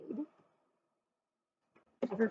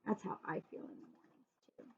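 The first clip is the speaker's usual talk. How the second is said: soft whispered confession; 3 semitones lower, 14 dB quieter, faster than usual. Real, not acted. Reader confiding to children, whispered. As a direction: whisper it like a secret.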